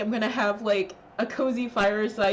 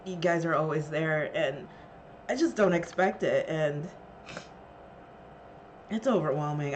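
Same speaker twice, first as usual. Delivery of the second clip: tearful; 11 semitones lower, 8 dB quieter than usual. Real, not acted. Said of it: "I'm really gonna cry now".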